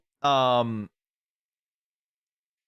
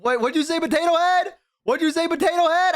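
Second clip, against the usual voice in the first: mocking taunt; 11 semitones higher than usual. Streamer retells taunting the bully in VR chat, mocking. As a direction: throw it back at her teasingly. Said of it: "we said".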